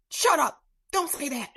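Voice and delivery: loud, high-pitched brush-off; mock-indignant, shutting a friend down; defensive retort. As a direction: act out a loud, defensive comeback.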